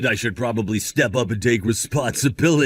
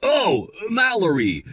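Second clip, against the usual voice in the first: pleasantly surprised; 5 semitones higher than usual. Acted. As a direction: greet someone you didn't expect to hear from. Greets a long unheard-from girlfriend.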